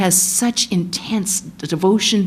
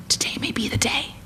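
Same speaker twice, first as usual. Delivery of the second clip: soft whisper, much quieter; 5 semitones higher, 7 dB quieter than usual. Acted. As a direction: breathe it like a secret prayer. Repeats the daily hope.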